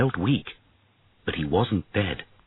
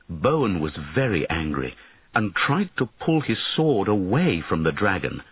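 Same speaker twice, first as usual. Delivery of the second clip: ordinary delivery, nothing marked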